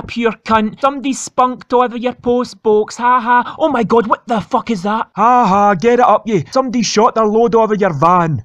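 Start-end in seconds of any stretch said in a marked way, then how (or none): none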